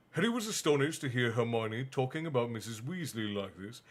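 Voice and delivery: usual speaking voice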